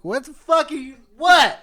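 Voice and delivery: high, loud confused yell; teasing imitation of a loud drunk friend; mock drunk shout. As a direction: yell it like a drunk who can't hear himself. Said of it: "shouting right now".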